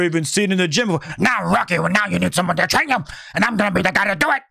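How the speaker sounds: fast, lightly voiced old trainer; Burgess Meredith impression. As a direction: rush through a weak Mickey impression. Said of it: "my Burgess Meredith impersonation".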